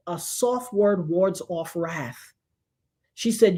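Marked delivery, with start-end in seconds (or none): 0.1-2.3 grandmother's low, slow, soft wisdom; slow, soft proverb. Retold or imitated. Grandmother teaching her granddaughter, slow and soft. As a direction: ease the line out like a calm elder. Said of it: "she looked at me and said".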